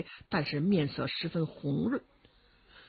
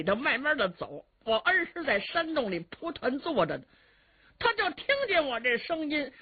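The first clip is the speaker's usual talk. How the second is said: higher-pitched trickster playing for time; wheedling, stalling captive; 6 semitones higher than usual. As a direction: stall your captor with a pleading explanation. Cheng Yaojin stalling his captor. (程咬金拖延时间，赔着笑央告的腔调)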